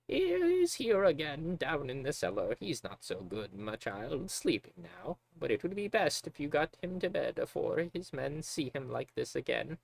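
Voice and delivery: put-on a sweet old matron, worried and gentle; kindly old cook. Magda warning the girl about her drunk father, in a high old voice.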